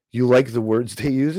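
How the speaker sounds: ordinary delivery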